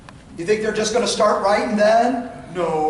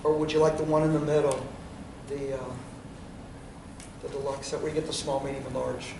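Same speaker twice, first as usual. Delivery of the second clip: quiet trial close; 5 semitones lower, 8 dB quieter than usual. Shown as a demonstration. Salesman demo to couple.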